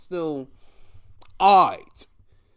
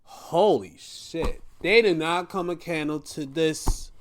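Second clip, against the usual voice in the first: ordinary delivery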